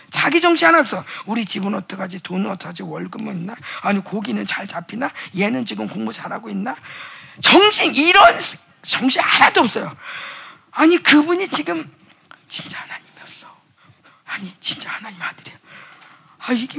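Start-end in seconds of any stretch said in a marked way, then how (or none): 1.2-6.7 worried mutter. Acted. Disciples' worries voiced. 12.5-15.6 hushed, softer awe; awed whisper. Acted.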